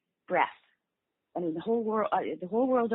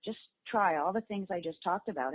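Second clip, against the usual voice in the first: normal talking voice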